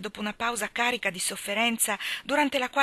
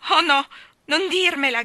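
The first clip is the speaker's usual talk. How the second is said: higher and much louder, a begging outcry; pleading cry; 7 semitones higher, 11 dB louder than usual. A narrator's reading. Anna begs Gilbert not to propose, pleading loud cry. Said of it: "gridò Anna con occhi imploranti".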